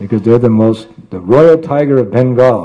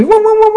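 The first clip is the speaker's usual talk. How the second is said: very high zooming whoosh; whooshing sound. Acted. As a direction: zip away fast. Dog flees very quickly.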